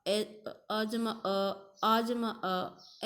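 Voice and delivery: each vowel sum drawn out slowly: "अ जमा अ", "आ जमा अ"; very slow demo. Shown as a demonstration. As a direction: lay out "अ जमा अ" and "आ जमा अ" very slowly for the class. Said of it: "ऐसे ही हमने". (बहुत धीरे, हर स्वर खींचकर "अ जमा अ, आ जमा अ")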